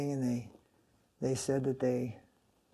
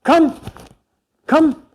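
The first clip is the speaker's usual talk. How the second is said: short, much higher and louder calling out; retold call; 12 semitones higher, 12 dB louder than usual. Guru calling devotees to follow him, loud short call. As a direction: call them to follow you, loud and brief. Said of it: "he goes".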